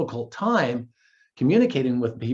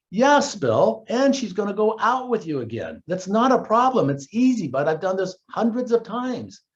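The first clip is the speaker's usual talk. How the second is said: higher-pitched, upbeat retold assurance; eager reassurance; 6 semitones higher than usual. Coach assures his buddy it's easy, eagerly reassuring. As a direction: build him up with confident, lifted energy.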